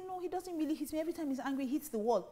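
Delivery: a wife downplaying his violence; defensive wife. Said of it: "Or say".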